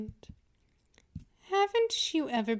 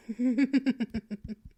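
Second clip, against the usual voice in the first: amused chuckle. Acted. Mistress chuckling at her pet drawing closer. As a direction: chuckle at your prey.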